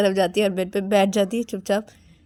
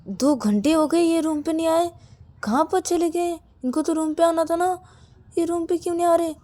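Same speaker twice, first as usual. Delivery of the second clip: high-pitched fretting to oneself; puzzled worry; 9 semitones higher than usual. A narrator's reading. Bride wondering where her late partner is, high and puzzled.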